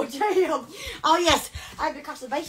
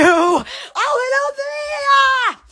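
drawn-out greeting; 7 semitones higher, much slower than usual. Acted. Greeting viewers theatrically.

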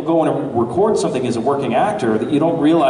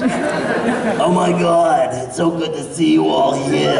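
smoker woman voice; slower than usual. Shown as a demonstration. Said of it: "he smoked or she smoked for about, you know, fifty years".

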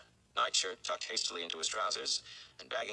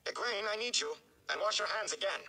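higher, urgent healer's call; Nynaeve calling; 13 semitones higher than usual. Acted. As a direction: summon the girl from inside. Nynaeve calling Egwene from house.

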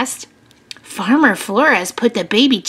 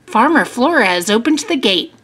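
plain reading voice

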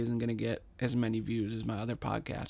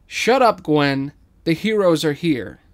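loud unison yell; crowd shout; 4 semitones higher, 12 dB louder than usual. Acted. The audience shouting down Gwen, loud unison yell. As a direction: shout her down together.

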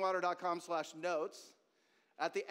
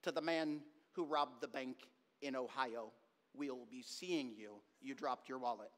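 Paul Harvey; 5 semitones lower, 4 dB quieter than usual. Retold or imitated. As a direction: drop the pitch and space out each phrase deliberately. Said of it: "he talked like this".

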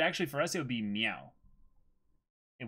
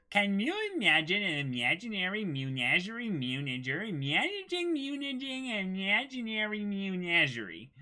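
put-on high cat-girl voice; 7 semitones higher than usual. Shown as a demonstration. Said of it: "I'm just gonna try and do this".

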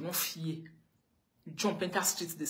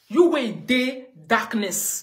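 drawn out, louder and higher; slow loud emphasis; 5 semitones higher, 9 dB louder, much slower than usual. Real, not acted. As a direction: stretch each word loud and slow. Ranting at a critic.

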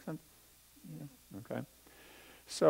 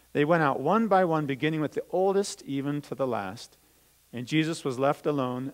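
put-on louder, lively storytelling voice for children; Bible story reading; 4 dB louder than usual. Teacher reading the Bible story to children, lively storytelling. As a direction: read it out as a story to the kids.

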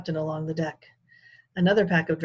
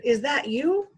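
muffled voice; 9 semitones higher, 5 dB louder, slower than usual. A narrator's reading. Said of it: "a muffled voice answered".

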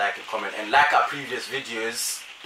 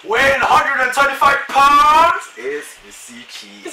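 booming ring announcer intro; boxing announcer; 11 semitones higher, 12 dB louder, slower than usual. Pretend. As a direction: announce him like a prize fight.

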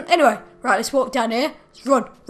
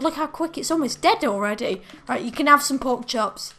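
normal talking voice

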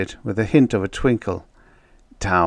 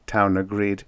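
plain reading voice

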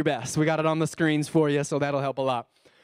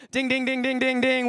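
mock bell; 7 semitones higher, 4 dB louder than usual. Game-show correct-answer bell.

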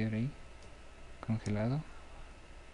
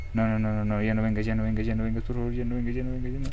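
loud, rapid repeated pleas, rising at the end; real panic, words tumbling out; panicked pleading; 6 dB louder, faster than usual. Real, not acted. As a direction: beg the monster to stay back. Monster approaching at the window.

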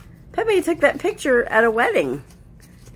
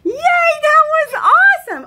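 excited cheering; 12 semitones higher, 8 dB louder, slower than usual. Real, not acted. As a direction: cheer her on like she just won. Cheering toddler on.

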